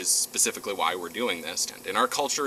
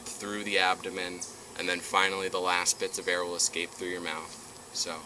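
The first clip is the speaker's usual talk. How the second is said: guided breathing; 6 dB quieter than usual. Acted.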